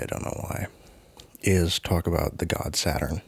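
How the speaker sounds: ordinary talk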